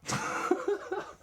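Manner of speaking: high wheezy laugh. Real, not acted. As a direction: crack up at your own attempt. Cracks up at own attempts.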